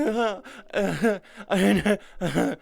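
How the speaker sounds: loud, high-pitched fake sobs; mock crying. Retelling an ex in tears during an argument, mock sobbing. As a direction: imitate an ex's tearful wailing. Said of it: "sitting there in tears going".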